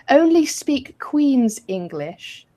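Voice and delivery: slow mock Queen's English; Queen's English accent. Playing posh for the co-host, slow Queen's English accent. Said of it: "I don't even know what accent I'm doing".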